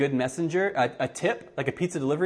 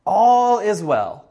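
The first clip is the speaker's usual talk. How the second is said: slow, loud, higher cry of all is well; cried out; 6 semitones higher, 7 dB louder, slower than usual. Acted. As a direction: call it out slowly and loud to the king. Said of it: "Ahimaaz cried out to the king".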